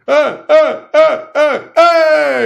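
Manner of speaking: high, loud shouted yelps, last one held; Rosario's war cry. Retold or imitated. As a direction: imitate a fierce little war cry.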